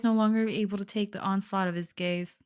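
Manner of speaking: usual speaking voice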